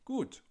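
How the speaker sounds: Gut said high and bright, German long u. Shown as a demonstration. Teacher showing a cheerful reply, Gut said high. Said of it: "Or you can say".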